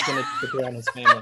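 laughing through the joke; high squeaky laugh over words. Real, not acted. Joking punchline while laughing.

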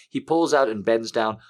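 plain reading voice

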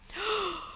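sharp shocked gasp; gasp of surprise. A narrator's reading. King shocked in court.

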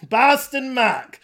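put-on slow, thick Boston accent. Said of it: "your Boston accent was too thick".

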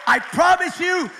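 loud, slow preaching climax; fiery preaching. Acted. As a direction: shout it out like a revival preacher. Preaching at full force.

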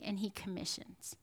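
breathy whispered ending; hushed sermon point. Real, not acted. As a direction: drop to a whisper on the last item. Whispered end of a list.

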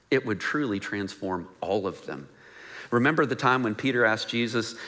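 ordinary talk, nothing marked

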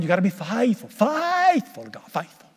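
lightly mock the strict camp preachers; mocking legalists. Preacher mocking his old strict church camp, mild parody. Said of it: "They talk like that all the time".

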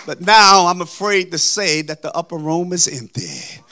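ordinary talk

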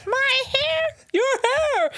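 put-on very high Jersey-accented Muppet voice, confused; a puzzled Rapunzel in a high Jersey accent. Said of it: "she's got the Jersey accent".

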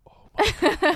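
laughing. Real, not acted. Laughing at partner's guesses.

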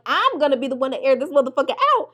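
fast, high, loud mock threat; a hammed-up tough-girl boast about carrying the gun; mock gangster bravado. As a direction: boast like you're ready to shoot, fast and loud. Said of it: "And I'm like".

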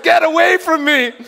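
high, loud get away; angry yell. Retold or imitated. Hardened stranger yelling at a pleading evangelist, loud and high. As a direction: shove him off with your voice.

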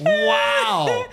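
a laughing, amazed wow, shouted out. Retold or imitated. Told to a cohost about a moving stranger, a laughing amazed shout. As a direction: shout your disbelief at the story. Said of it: "I'm just sitting there like".

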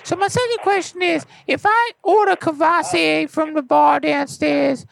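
very high cartoon rapper voice; Lil P P voice. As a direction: talk like a helium-pitched rapper. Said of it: "I'll put him on the phone".

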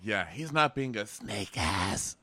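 ordinary talk

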